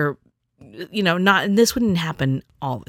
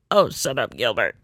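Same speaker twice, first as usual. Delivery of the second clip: mock grumpy teacher; 6 semitones lower than usual. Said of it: "he would sound really grumpy".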